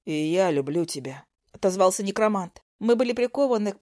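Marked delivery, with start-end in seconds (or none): 0.0-1.2 low male voice. A narrator's reading. Zak answers low.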